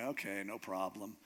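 low, soft, easy agreement; casual assent. Acted. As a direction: toss it off as no trouble. God casually agrees to the test.

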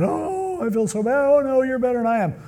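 hammed-up contrition, pitched well up; put-on remorse. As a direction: exaggerate a weepy, self-abasing apology. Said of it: "putting on that".

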